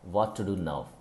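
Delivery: ordinary delivery, nothing marked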